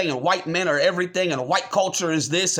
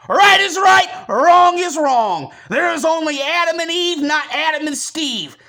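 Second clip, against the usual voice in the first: furious shout; 8 semitones higher, 6 dB louder than usual. Real, not acted. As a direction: bellow it like a slogan. Shouting his creed at viewers.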